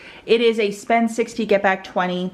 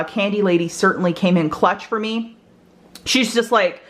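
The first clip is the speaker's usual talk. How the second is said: ordinary talk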